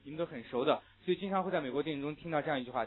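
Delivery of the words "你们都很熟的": ordinary talk, nothing marked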